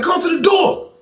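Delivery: high-pitched summons to the door; acted call through a closed door. Acts out calling him to door.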